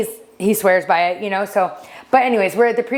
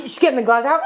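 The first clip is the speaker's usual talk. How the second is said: ordinary talk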